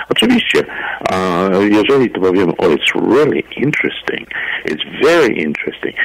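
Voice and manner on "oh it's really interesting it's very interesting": emphatic "really" and "very", meant positively; positive, genuine interest. Shown as a demonstration. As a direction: sound sincerely interested, leaning on "really" and "very".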